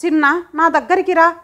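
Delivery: mother calling her daughter from bed; calling loudly. A narrator's reading. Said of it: "గట్టిగా పిలిచింది కూతుర్ని".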